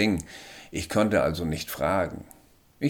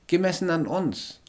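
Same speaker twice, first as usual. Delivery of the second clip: higher little alien voice, factual; matter-of-fact alien; 12 semitones higher than usual. A narrator's reading. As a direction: state it plainly as the alien. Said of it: "antwortete Charles sachlich".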